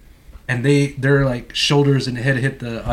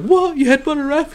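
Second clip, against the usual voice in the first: high, shocked mock gasp of a question; mock disbelief; 14 semitones higher than usual. Bystanders hearing about the headbutt, high mock shock. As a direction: play the stunned bystander, pitch jumping up.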